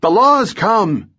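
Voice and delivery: high, drawn-out alarm call; yelled warning. A narrator's reading. Old woman warns son of the law, high drawn-out yell. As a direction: yell it slowly and high to warn him. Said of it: "she yelled".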